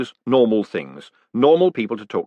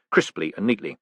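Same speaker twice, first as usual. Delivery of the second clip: usual speaking voice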